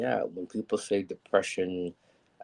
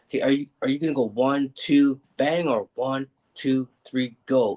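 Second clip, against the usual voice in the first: loud, raised pitch, acting out nerves; anxious questioning; 7 semitones higher, 9 dB louder than usual. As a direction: pin down the count in a loud, high panic. Nervously checking the surgeon's count.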